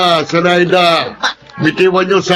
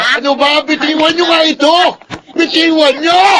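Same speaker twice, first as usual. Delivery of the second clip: exasperated order; 8 semitones higher than usual. Acted. Vendor ordering them let go.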